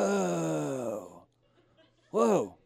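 lightly voiced movie-star "whoa"; drawn-out whoas with a falling tune; Keanu Reeves. Puppet trying on a celebrity voice, mild imitation. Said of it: "he'll do his best Keanu Reeves".

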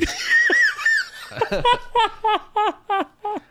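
high, loud burst of laughter; real cracking-up laugh; big high laugh. Real, not acted. Big laugh after the joke.

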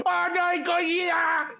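high, drawn-out mock cry; panicked Japanese movie extra. Imitating the original Japanese films, hammed-up panicked cry. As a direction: wail it in comic monster-movie terror. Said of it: "there is always some guy going".

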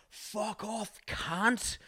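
high, hushed imitation of a yell; mock yelling. Rider re-enacts swearing at the cab driver, soft mock yell. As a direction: yell it drawn out with the volume held back. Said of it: "like, yelling at this guy".

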